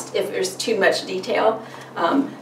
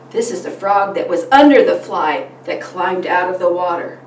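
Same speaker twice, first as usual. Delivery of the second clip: animated storytime voice with gasps and suspense; reading to children; 5 semitones higher than usual. Shown as a demonstration. Parent reading a frog book to a child, animated.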